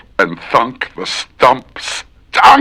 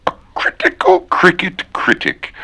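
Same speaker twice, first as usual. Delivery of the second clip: high put-on voice; 11 semitones higher than usual. Shown as a demonstration. High odd voice.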